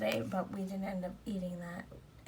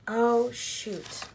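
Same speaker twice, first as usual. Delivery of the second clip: sudden loud yelp of remembering, higher; surprised exclamation; 5 semitones higher, 9 dB louder than usual. Real, not acted. Vlogger suddenly remembers one more task, loud surprised blurt. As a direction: blurt it out as it hits you.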